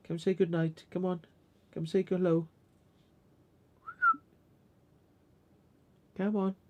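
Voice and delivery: high, soft coaxing call to a dog; high-pitched come-on to the pet. Real, not acted. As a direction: lure the dog up sweetly.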